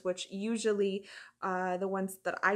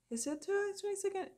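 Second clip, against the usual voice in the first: higher, softer, quick question; quiet aside; 11 semitones higher, 6 dB quieter, faster than usual. Real, not acted. Actress double-checking the date off to the side, quiet aside. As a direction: check it under your breath, pitched up.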